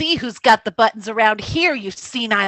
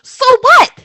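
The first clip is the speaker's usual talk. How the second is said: defiant shout; 9 semitones higher, 7 dB louder than usual. Acted. Velda defiant outburst.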